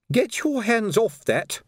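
high, slow indignant command; indignant order. A narrator's reading. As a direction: warn him off, pitched well up. Woman defends her basket.

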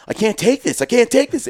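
mock panic rising to breaking point; panicked. Pinned-down brother-in-law losing it before charging, panicked cry. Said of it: "He's like".

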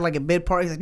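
ordinary talk

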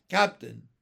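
low, loud reprimand; sharp. Acted. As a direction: cut in firmly on a superior. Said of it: "the first officer said sharply".

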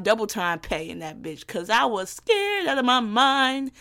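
usual speaking voice

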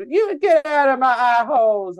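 mock the sketch line friends kept repeating; imitating the eye hole guy. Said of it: "It was a whole evening".

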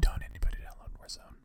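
a quick joking whisper; hushed, teasing warning to listeners; whispered aside. Acted. Cohost whispers a joke aside to the audience.

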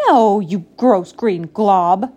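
loud, slow and punched; loud, drawn-out insult; mean insult. A narrator's reading. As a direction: spit it out word by word, with disgust. Duck snaps meanly at Frog.

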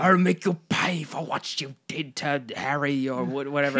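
a hint of a British accent, half-laughing; lightly faked British accent, teasing; mock British accent. Mocking Amber Heard's role to a co-host, British accent. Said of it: "Talking in a British accent".

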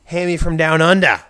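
put-on Australian accent, loud at first. Host playing an Aussie character, put-on Australian accent. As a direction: do a hammy Australian from Down Under. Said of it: "insult your culture or your accent".

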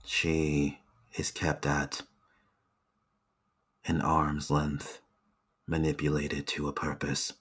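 low, hushed loyal retainer; hushed servant voice. Acted. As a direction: speak softly like an entranced servant. NPC answers quietly.

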